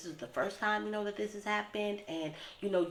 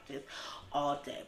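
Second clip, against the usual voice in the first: ordinary talk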